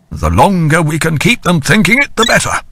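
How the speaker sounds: lightly voiced older officer; a hint of a commanding admiral; Lenton's voice. A narrator's reading. Lenton addressing the assembled captains after patrol.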